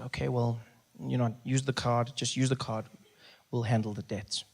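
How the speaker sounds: quiet, low, resigned reply; subdued, worried consent; stressed resignation. Acted. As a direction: give in softly under stress. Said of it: "I'm stressed".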